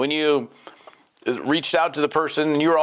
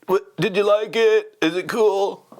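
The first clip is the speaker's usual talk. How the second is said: lazy dude; 7 semitones higher, slower than usual. Retold or imitated. Mocking a lazy follow-up text to a prospect, hammed-up slacker voice. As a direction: ham up the careless buddy text. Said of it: "ping them on a text and go".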